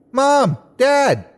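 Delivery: high yelled call; joyful high yell. A narrator's reading. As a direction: yell out to mom and dad. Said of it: "I yelled".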